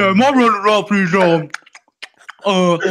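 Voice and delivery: very high, loud garbled imitation of eating; hammed-up talking through food to tease a friend; mock mouth full. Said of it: "Are you eating right now, bro?".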